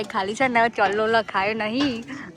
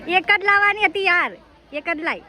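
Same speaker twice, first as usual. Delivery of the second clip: exasperated protest; 9 semitones higher, 7 dB louder than usual. Real, not acted. Complaining uncle brought two.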